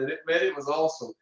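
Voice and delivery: normal talking voice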